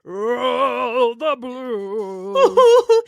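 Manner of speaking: long drawn-out mock blues croon; imitated blues singer. Mimicking the actor's blues riff, imitated blues singer. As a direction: drag it out low, like a hammed-up bluesman. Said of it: "and then he's like".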